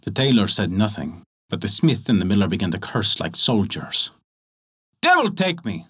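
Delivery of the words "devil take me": mild cursing shout. Acted. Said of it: "shouted the other".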